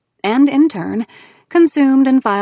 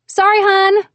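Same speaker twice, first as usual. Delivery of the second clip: loud apology; 13 semitones higher, 8 dB louder, slower than usual. Acted. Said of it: "switching back to my voice".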